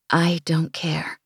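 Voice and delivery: slow, deliberate and determined; measured words. Acted. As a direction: weigh each word, slowly. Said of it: "measuring her words".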